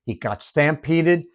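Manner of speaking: usual speaking voice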